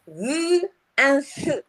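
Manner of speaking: "v and th" slow and drawn out; exaggerated th practice. Shown as a demonstration. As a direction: stretch "v" and "th" slowly. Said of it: "you've got to make sure you dramatize".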